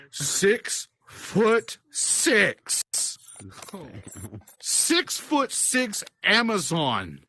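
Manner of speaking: very slow, each word set apart; awed emphasis. Real, not acted. Storyteller describing the relief bouncer, slow and awed. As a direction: spell out her size with long pauses.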